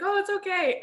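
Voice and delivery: high mock comforting voice; lightly voiced consoling friend; soothing. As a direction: imitate a friend gently comforting. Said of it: "he's like".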